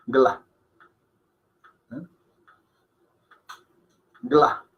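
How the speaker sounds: "Gla" as one syllable, first A almost silent; Hindi pronunciation. Shown as a demonstration. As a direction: run g straight into l in "Gla". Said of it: "que casi no suena".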